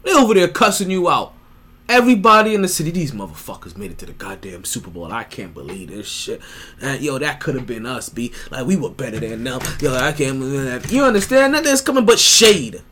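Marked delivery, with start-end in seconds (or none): 2.9-10.9 grumbling fan. Retold or imitated. City cussing, voiced quietly.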